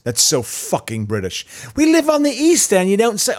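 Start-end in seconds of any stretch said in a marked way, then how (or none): 1.8-3.3 high, hammed-up Cockney accent; mock a Cockney East Ender, much higher. Mocking British soap characters from the East End, Cockney accent. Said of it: "it'd be a Cockney accent".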